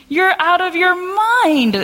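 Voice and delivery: high, drawn-out disbelief; incredulous. Acted. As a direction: scoff at it loudly as absurd, stretching it out. Crowd disbelieves servant girl in story.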